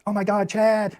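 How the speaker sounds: high, gushing squeal of delight; girl greeting Chad at the door, gushing excitedly. Retold or imitated. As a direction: squeal it like a thrilled girl greeting her crush. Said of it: "She's just like".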